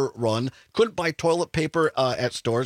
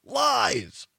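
mock heckle, pitched up and drawn out; imitated shout of one drawn-out word; retold shout; 8 semitones higher than usual. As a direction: call it out like a heckler in the crowd.

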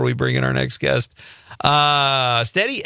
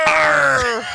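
growling yell; 13 semitones higher, 4 dB louder than usual. Pretend. Yelling at the TV, echoing.

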